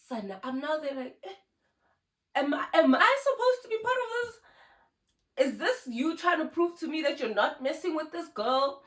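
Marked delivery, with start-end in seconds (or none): 2.3-5.5 incredulous. Real, not acted. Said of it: "her own bewildered reaction".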